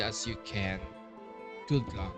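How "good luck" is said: usual speaking voice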